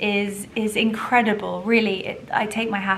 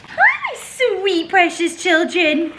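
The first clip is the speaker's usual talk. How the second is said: much higher, slow coo with a laugh; 10 semitones higher, 8 dB louder, much slower than usual. Real, not acted. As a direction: coo to the dogs. Greeting the dogs.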